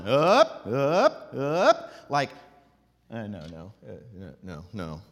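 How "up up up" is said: loud "up" swooping upward each time; three forceful rising "up"s. Shown as a demonstration. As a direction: heave each "up" up the hill. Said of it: "goes up the hill".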